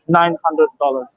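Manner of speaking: normal talking voice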